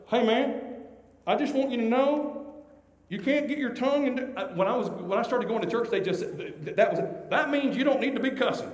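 lightly put-on voice of a church busybody; churchgoer imitation. Imitates church people scolding cussing.

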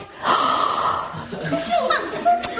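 long, exaggerated intake of breath; drawn-out retold gasp; shocked gasp. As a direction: gasp in mock horror. Mother's retold shocked reaction.

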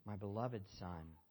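low and soft, a father's gentle blessing; tender father's voice. Acted. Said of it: "And the Father says".

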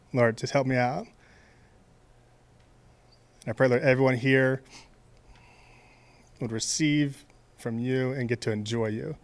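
soft, low opening prayer. Real, not acted. Pastor opens the sermon by praying softly for everyone. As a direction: pray quietly over the room.

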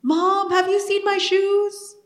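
put-on high, drawn-out kid's call; child calling Mom.

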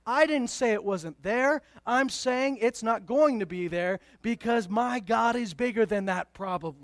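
normal talking voice

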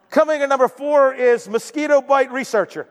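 ordinary delivery

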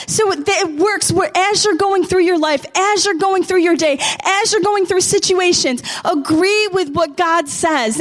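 high-pitched, driving exhortation; fervent preaching. Real, not acted. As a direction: preach it urgently to the congregation.

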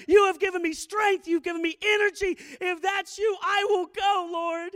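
shouted high prayer, building to a roar; preaching at full force. Acted. Preacher praying aloud to the Lord, shouting at full force. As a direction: cry it out to the Lord with everything.

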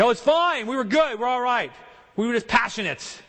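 high, breezy brush-off; mock excuse. Preacher imitating couples excusing their shouting, breezy. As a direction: play the quarreler waving it away. Mimicking excuses after a quarrel.

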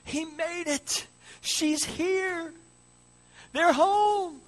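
high, drawn-out jubilant yells; imitated crowd roar, rising in volume; cheering at full lungs. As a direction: holler like a crowd at the finish line. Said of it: "cheering at the top of their lungs".

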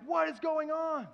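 high, loud startled cry; alarmed outcry. Pretend. Congregation startled, crying out in alarm. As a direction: shout it out like sudden chaos broke loose. Panicked congregation, ducking for cover.

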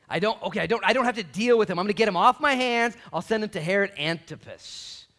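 loud, high, fed-up shout; exasperated Pilate. Acted. Pilate loudly refusing to deal with Jesus, shouting. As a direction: shove the problem away, yelling.

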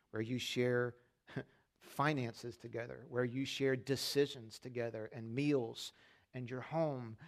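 a hint of a tender divine voice; God's tender voice. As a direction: speak it like a gentle promise. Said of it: "And he says".

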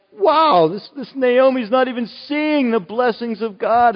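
high, loud amazed wow; incredulous exclaim. Real, not acted. Preacher exclaims at Naomi's blindness, incredulous and high. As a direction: marvel at her blindness, voice up high.

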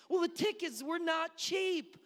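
high, loud, slow complaint; exasperated dad. Acted. Dad whose kids want to leave the museum, exasperated. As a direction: hammer it out slowly, worked up.

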